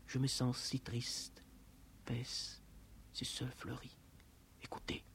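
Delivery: very soft recitation, nearly a whisper; hushed sadness. Acted. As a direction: let it fade into a hush. Sad hushed passage of recitation. (murmure triste)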